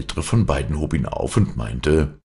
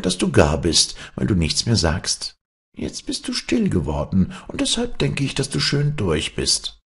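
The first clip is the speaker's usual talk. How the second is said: leicht höhere, verschlagene Erzählstimme; spöttisch der Frau zureden, etwas höher; listige Figur; 6 semitones higher than usual. Acted. Ulla Kanyana mimics the old woman. (verschmitzt, etwas höher)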